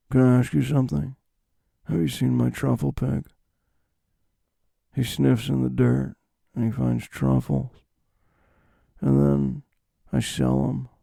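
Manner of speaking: soft, understated loner impression; Nick Cage. Truffle hunter explaining his pig, soft understated impression. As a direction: say it quietly, with long gaps between phrases. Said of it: "That's my Nick Cage impression".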